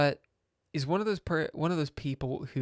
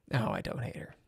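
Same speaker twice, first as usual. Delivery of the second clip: whispered retraction; 5 dB quieter, faster than usual. Real, not acted. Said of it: "taking back the joke".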